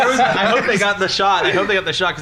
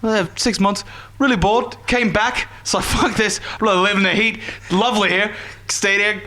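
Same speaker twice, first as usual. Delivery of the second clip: higher-pitched British retelling that turns Australian; attempted British accent; 4 semitones higher than usual. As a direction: retell it as a Brit, slipping into Australian. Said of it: "it's going to be Australian".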